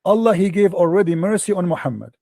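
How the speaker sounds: usual speaking voice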